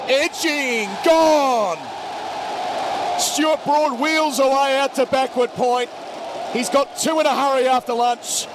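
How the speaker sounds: high, loud shout of a wicket; excited wicket call. Real, not acted. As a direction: yell it as the edge carries. Wicket falls, commentator erupts.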